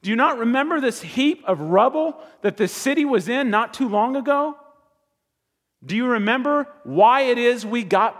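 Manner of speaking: high, loud rebuke of a stern leader; Nehemiah scolding. Acted. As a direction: scold the people for forgetting. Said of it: "he says to these people here".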